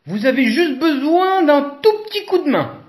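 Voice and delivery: cheerful advice from a trotting zebra; high-pitched character voice. A narrator's reading. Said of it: "dit Zèbre".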